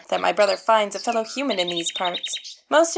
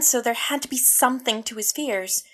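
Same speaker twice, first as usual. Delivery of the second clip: usual speaking voice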